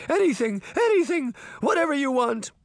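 very high, panicked babble; frantic pleading. A narrator's reading. Deputy offering the Horseman anything, frantic pleading. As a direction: plead desperately, voice way up.

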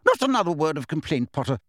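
high, clipped Scottish teacher's reprimand; a strict professor scolding a noisy pupil; stern Professor McGonagall. A narrator's reading. Professor rebukes Angelina in the Great Hall, stern.

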